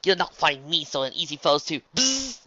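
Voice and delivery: high, loud, boastful cry; triumphant. A narrator's reading. Luna dodging the massagers, triumphantly boasting. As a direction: crow it until cut off. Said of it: "dodged with a triumphant smile".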